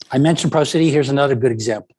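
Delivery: ordinary talk, nothing marked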